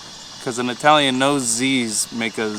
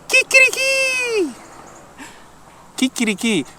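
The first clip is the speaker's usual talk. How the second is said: rooster crow; 4 dB louder than usual. Shown as a demonstration. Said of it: "roosters say".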